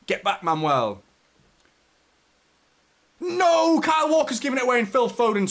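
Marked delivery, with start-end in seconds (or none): 3.2-5.5 high, loud groan of despair; frustrated shout. Real, not acted. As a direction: yell at the mistake. Live match reaction.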